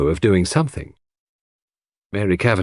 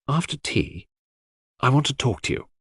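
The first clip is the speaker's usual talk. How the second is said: slightly hushed, higher confidential request; lowered voice; 5 semitones higher, 5 dB quieter than usual. A narrator's reading. Cynthia privately asking Hastings to talk after tea, lowered voice. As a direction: ask discreetly, voice a little dropped. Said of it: "dropping her voice".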